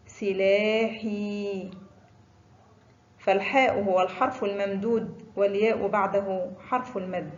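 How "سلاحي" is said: سلاحي very slowly, final حي held long; drawn-out example word. Shown as a demonstration. Teacher showing pupils the long vowel, slow and stretched. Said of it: "ينطق حي".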